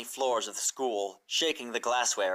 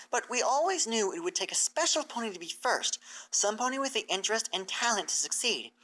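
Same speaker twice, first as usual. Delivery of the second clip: raised, earnest teacher pony explaining; bright scholarly mare, raised pitch; Twilight's voice; 7 semitones higher than usual. Acted. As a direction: lay out the plan to her hopeful and clear. Twilight explains the school plan.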